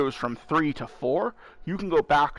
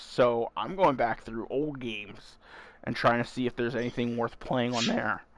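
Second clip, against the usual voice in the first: ordinary delivery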